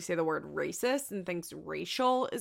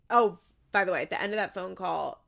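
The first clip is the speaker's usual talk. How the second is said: ordinary talk, nothing marked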